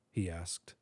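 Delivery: usual speaking voice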